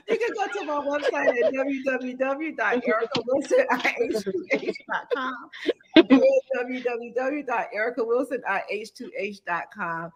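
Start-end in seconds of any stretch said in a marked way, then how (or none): 0.1-5.3 website read through laughter; laughing. Real, not acted. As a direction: giggle through the address.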